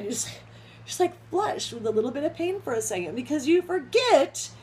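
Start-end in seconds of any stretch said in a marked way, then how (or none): none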